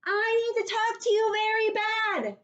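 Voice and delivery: put-on high, loud little girl yelling for help; Junie B. yelling. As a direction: cry for help like a panicked kid. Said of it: "I shouted some more".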